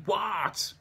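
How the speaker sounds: high shouted What over a noisy line; shouting. Acted. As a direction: yell it down the phone. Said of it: "someone answers shouting".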